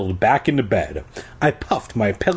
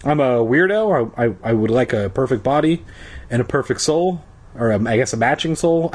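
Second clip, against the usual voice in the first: ordinary delivery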